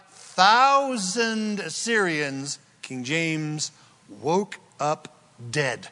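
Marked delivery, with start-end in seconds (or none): none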